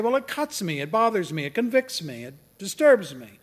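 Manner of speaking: high, loud mock complaint; acted protest. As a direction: pile up the objections like an agitated listener. Voices an objector's mounting complaint.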